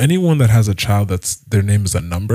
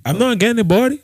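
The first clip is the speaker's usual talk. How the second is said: put-on much higher Liberian accent, phone-call tone; 13 semitones higher, faster than usual. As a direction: mimic a Liberian caller getting no answer. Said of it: "that accent is probably the easiest to imitate".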